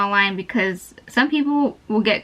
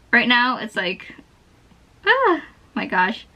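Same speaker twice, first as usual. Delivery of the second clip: usual speaking voice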